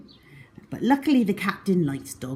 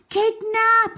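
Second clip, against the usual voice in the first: high, loud shout of alarm; yell of alarm; 5 dB louder than usual. A narrator's reading. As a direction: cry out the danger. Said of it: "I yelled".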